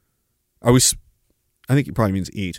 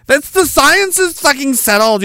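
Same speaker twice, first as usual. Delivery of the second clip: high, slow indignant squawk; mock zealot outrage; 10 semitones higher, 3 dB louder, slower than usual. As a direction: insist shrilly as a parody believer. Mock climate zealot ranting.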